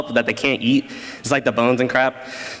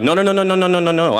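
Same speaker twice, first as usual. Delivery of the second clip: rapid-fire, higher-pitched acted denial; hurried denial; 4 semitones higher, much faster than usual. Son protesting to his mother, hurried mock denial. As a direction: rattle off a run of protests. Denying to mother he's a killer.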